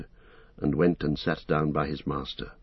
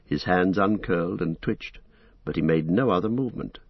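ordinary delivery, nothing marked

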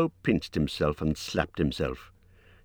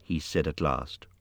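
plain reading voice

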